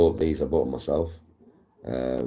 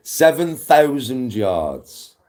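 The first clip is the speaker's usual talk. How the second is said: slow dramatic emphasis; 8 semitones higher, 6 dB louder, much slower than usual. Real, not acted. Golfer marvelling at the course to his viewers, slow loud emphasis. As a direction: stretch every word out, high and loud.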